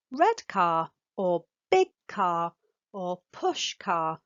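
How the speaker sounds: slow, stressing the added word before "car"; expanding with stress. Shown as a demonstration. Parent expanding a child's word "car", slow and stressed. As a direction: model "red car," "big car," "push car" slowly for a toddler. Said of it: "adding an extra word onto what your child says".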